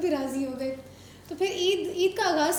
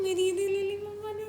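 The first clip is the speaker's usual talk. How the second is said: sing-song fake crying complaint; mock crying; 5 semitones higher than usual. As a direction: imitate a child whining tearfully. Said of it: "रोते नहीं हो".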